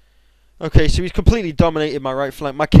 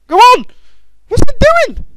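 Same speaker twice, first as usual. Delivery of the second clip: high, loud squeal urging the soldier on; cheering a soldier on screen, high and loud; excited squeal; 9 dB louder than usual. Real, not acted. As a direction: shriek in giddy disbelief. Cheering unit in game.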